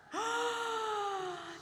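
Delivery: high, amazed gasp; storyteller's high gasp of wonder; sharp gasp. Acted. Birth of baby Jesus.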